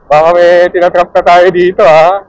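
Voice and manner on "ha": ordinary delivery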